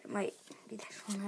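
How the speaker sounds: whispering. Real, not acted. Quiet aside during pack opening.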